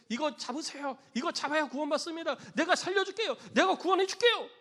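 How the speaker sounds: loud, high, building shout; rescuer's plea rising to a shout; urgent rescuer's call. Acted. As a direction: call out to someone drowning, pitch climbing. Rescuer calling to drowning man.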